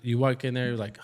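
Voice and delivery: ordinary delivery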